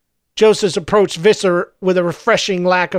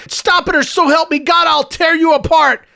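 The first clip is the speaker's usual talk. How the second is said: high, raging threat; enraged hero at breaking point; shouted threat; 8 semitones higher than usual. A narrator's reading. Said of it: "he shouted".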